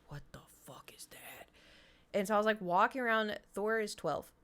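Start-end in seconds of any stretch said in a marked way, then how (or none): 0.1-1.5 bewildered whisper. Retold or imitated. Streamer reliving strange laundry noise, bewildered whisper.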